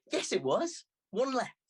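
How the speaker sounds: high, insistent teasing; girl insisting on the rules; cheeky girl. Acted. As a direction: hold him to it playfully. Girl counters his protest.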